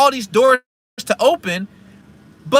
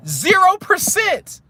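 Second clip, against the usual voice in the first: Zero stretched out, pitched way up; emphatic zero percent; 9 semitones higher, slower than usual. Real, not acted. Telling viewers about the car deal, emphatic and slow. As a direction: land hard on Zero, slowly.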